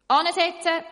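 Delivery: loud, high order; sudden shout. Acted. Woodcutter ordering everyone to sit, sudden shout. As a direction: bark the order. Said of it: "ruft plötzlich der Holzfäller".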